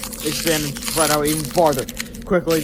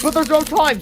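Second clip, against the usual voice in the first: put-on higher, worried boy's protest; anxious kid voice; 12 semitones higher, 5 dB louder than usual. A narrator's reading. Hill warning George there is no time, high and anxious. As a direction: plead with rising panic. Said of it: "said Hill".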